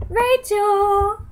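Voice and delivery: loud, high, sung call; calling out Rachel. Real, not acted. Calling to Rachel in another room, loud and sung. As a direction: call across the house, drawn out.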